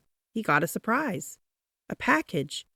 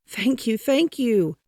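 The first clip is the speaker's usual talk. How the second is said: grateful; 6 semitones higher, 5 dB louder than usual. A narrator's reading. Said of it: "said the grocer".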